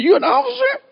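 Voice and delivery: a policeman asks the lieutenant, stunned he's an officer; shocked disbelief. Retold or imitated. High incredulous question. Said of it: "He looked at me really shocked".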